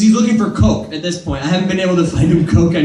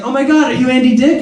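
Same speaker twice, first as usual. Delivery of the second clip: put-on starstruck girl; 7 semitones higher, faster than usual. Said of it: "the girl's like".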